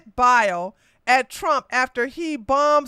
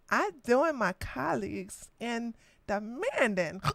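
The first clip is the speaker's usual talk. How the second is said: put-on softer, slurring drunk old woman voice; drunk Nancy Pelosi; 6 dB quieter than usual. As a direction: slur it like a tipsy elderly politician. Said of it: "I gotta sound like a drunk N-, Nancy Pelosi".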